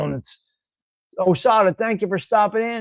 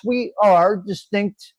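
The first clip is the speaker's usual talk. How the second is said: ordinary delivery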